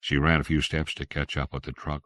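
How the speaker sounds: ordinary delivery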